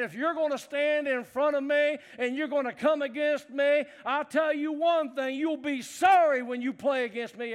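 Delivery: loud taunting yell at an opponent; trash-talk shout. Retold or imitated. Player taunting a rival on the field, loud shout. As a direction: bark the threat across the line. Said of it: "And I remember saying".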